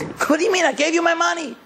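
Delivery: high, outraged mock yell; retold screaming. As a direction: cry out like he's been robbed. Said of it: "And he starts screaming".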